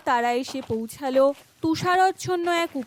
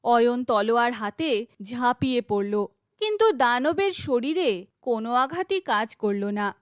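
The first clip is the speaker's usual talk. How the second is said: plain reading voice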